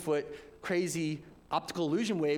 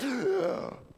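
mock gasp for air; 7 semitones higher than usual. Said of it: "And you're like".

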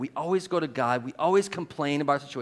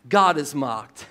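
slow and louder, each word weighted; emphatic punchline; 6 dB louder, slower than usual. Real, not acted. Preacher to the church, slow heavy emphasis. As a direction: land "God is mocked" slowly like a verdict. Preacher drives home point.

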